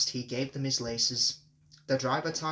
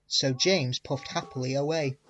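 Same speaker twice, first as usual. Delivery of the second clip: plain reading voice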